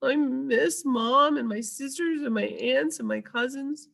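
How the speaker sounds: high tearful little voice; crying out the whole family; young elephant sobbing. A narrator's reading. Ruby tells Ivan through tears, high voice. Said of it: "Ruby sobs".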